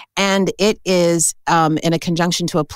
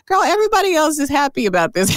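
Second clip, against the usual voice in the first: very high, quick retold exclamation; teasing retort; 11 semitones higher, faster than usual. Astrologer to her Taurus friend about the conjunction, teasing. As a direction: tease a friend in a raised, hurried pitch.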